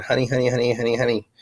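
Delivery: lightly imitated sing-out, quick repeated word; a hint of a put-on diva voice. Possessed speaker channeling a pretended star, light impression. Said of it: "they pretended that [name] jumped into my body".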